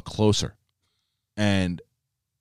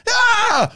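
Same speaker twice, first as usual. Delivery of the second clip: high, loud reenacted stadium scream; crowd yell; 5 dB louder than usual. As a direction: yell like a fan at a ball game. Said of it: "I was like".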